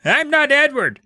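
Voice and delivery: put-on high-pitched kid insisting; insistent protest. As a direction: push back hard in a high voice. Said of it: "my brother insisted".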